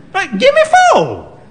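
high, loud order; bossy old woman. Acted. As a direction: snap out the order.

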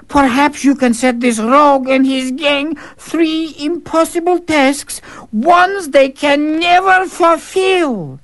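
high, scheming servant, slow at the end; a crafty courtier laying out his plan; sly servant voice. Acted. Servant slyly advising the Tsar, high voiced.

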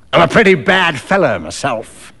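lightly voiced rogue bragging; a rough fellow's casual boast; coarse stranger, boastful. A narrator's reading. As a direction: own up to it with relish. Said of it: "the stranger remarked".

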